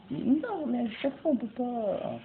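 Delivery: high-pitched, exasperated complaint; frustrated protest. Real, not acted. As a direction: protest high, easing down toward the end. Frustrated by a locked door. (plainte frustrée, très aiguë)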